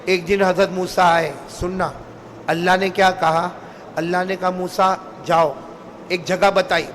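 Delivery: low, soft storytelling voice; story narration. A narrator's reading. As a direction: draw the listeners in quietly. Quiet storytelling to congregation. (دھیمی آواز میں قصہ گوئی)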